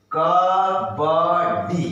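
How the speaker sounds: syllables stretched; slowly sounded out; slow drawn out. Shown as a demonstration. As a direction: pull each sound long. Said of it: "slow drawn demo".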